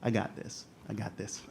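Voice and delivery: hushed, self-assured aside; soft, confident self-talk; quiet confidence. Retold or imitated.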